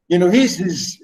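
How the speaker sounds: ordinary delivery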